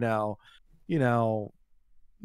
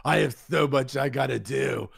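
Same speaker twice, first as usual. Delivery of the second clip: hammed-up crotchety pensioner voice; put-on cantankerous old-lady impression; cranky old lady. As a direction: imitate a grumbling old woman. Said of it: "She goes".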